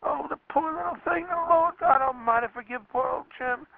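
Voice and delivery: put-on weep over the deaf child; father breaking down in remorse; tearful lament. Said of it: "I burst out a crying".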